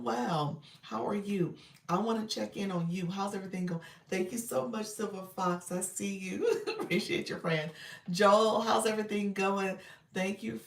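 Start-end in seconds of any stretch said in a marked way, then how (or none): none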